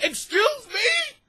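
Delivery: high, slow, incredulous shout; mock outrage, stretched and high; outraged. Man answering the kids' insults, outraged high shout. Acted outraged comeback to the kids.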